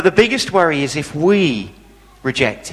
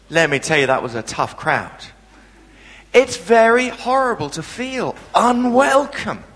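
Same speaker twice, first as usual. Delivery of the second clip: normal talking voice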